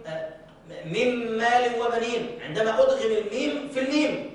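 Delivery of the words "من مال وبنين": slow tajweed reading; slow recitation with merged nun. Shown as a demonstration. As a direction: recite مال slowly, the nun merged into the mim with a held nasal hum. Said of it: "أدغم النون الساكنة في الميم".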